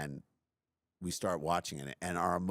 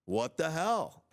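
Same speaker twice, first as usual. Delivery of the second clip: high-pitched mock exasperation; upset parent scolding daughter after a bad hole, mock exasperated; frustrated parent; 5 semitones higher, slower than usual. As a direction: replay your angry outburst at her, half in jest. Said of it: "instead of me being like".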